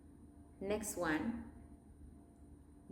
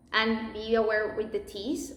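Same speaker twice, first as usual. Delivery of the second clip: ordinary delivery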